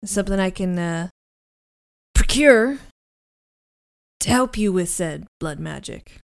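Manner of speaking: lightly voiced scratchy deep male voice; Meyer Wolfsheim. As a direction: put on a slightly deep, scratchy man's voice. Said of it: "two dueling deep voices".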